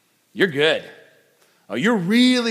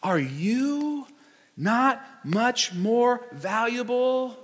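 preaching at full force; 7 semitones higher than usual. Acted. Preaching climax.

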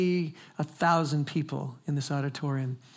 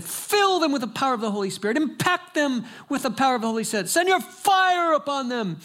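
much higher, louder revival call rising to a peak; preacher crying out fire over the couple, ever higher and louder; impassioned preacher cry; 10 semitones higher, 5 dB louder than usual. Retold or imitated. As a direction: build up into a fiery prophetic shout. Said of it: "And he said".

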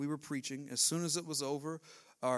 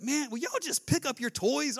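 put-on a fed-up mom, a bit higher, griping at the kids; exasperated mom; 10 semitones higher, 5 dB louder than usual. Mom griping at kids about their toys, exasperated. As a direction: toss off an annoyed parent's complaint.

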